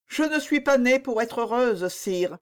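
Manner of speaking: high, wistful princess voice; melancholy. A narrator's reading. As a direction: answer with resigned melancholy. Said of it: "répondit mélancoliquement la princesse".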